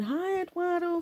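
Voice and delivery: high, sweet flirty greeting, lightly voiced.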